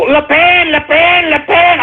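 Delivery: high mock chant of a name; mocking supporters. Tired of hearing all these people, high mocking chant. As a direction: parrot the fans in a high voice. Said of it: "I'm tired of hearing all these people".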